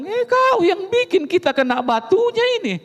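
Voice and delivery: high, teasing accusation; playful retort. Retold or imitated. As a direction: tease the wife with mock blame.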